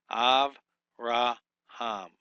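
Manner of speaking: Av, Ra, Ham split into separate syllables. Shown as a demonstration. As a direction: break Avraham into its syllables slowly. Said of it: "So it's Av".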